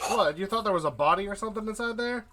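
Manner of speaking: high, fast teasing 'what?' at the player; amused disbelief. Real, not acted. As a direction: poke fun at the wild guess, rushing it out. GM teases player's suspicion.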